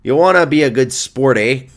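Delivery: Canadian hockey coach with a tagged-on "eh"; Canadian accent. Acted. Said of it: "it's a knockout Canadian accent".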